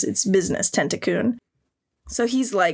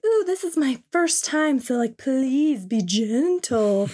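put-on high girly coo, slowing down; a lightly put-on shy heroine; high mock girl voice, shy; mock anime girl; 5 semitones higher, slower than usual. Voicing the girl in the hentai.

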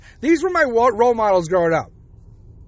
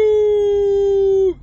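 mock Siu shout; 10 semitones higher, 3 dB louder than usual. Son's idol Ronaldo's celebration cry.

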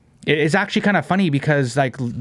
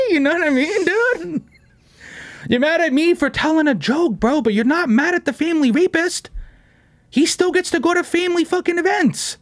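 much higher, laughing disbelief; riled-up laughing rant; worked-up rant; 12 semitones higher than usual. Real, not acted. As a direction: keep ranting way up in pitch.

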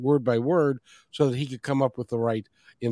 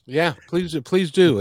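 ordinary talk, nothing marked

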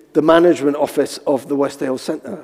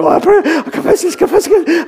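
high, rushed mock-panic babble; panicked confessing; 12 semitones higher than usual. Nervous young man confessing in the corridor, mock frantic. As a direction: babble confessions in comic desperation.

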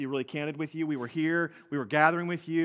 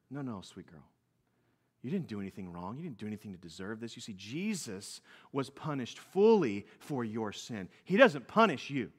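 much softer and lower, gentle and reassuring; tender comfort; 7 semitones lower, 3 dB quieter than usual. Retold or imitated. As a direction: soothe her like a father to his hurt child. Father comforting crying daughter.

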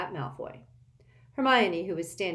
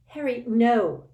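moaned; 6 semitones higher, 9 dB louder, slower than usual. A narrator's reading. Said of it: "moaned Hermione".

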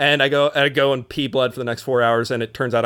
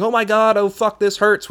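mock yell; 6 semitones higher than usual. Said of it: "what I was yelling the first time quite loudly".

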